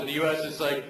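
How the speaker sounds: usual speaking voice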